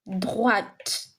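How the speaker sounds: "Droite" said drwat; teacher modelling "Droite" with its last consonant; sounded final t. Shown as a demonstration. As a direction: release the t at the end of "Droite". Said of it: "E at the end".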